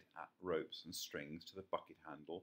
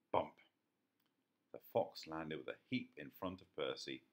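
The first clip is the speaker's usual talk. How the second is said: usual speaking voice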